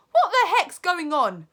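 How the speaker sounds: put-on high, loud bratty boy; puzzled kid demanding answers; boy asking. Bruno asking the witches, high and loud. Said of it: "Bruno asked".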